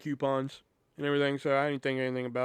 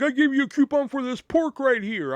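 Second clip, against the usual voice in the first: angry customer; 10 semitones higher, 5 dB louder than usual. Retold or imitated. Customer arguing loudly.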